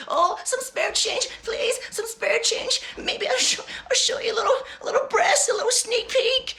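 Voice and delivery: high giggly begging voice; hammed-up high plea with laughs; pleading beggar. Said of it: "She just like".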